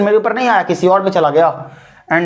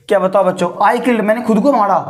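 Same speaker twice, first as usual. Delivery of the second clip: usual speaking voice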